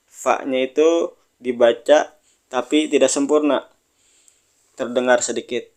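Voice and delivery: ordinary delivery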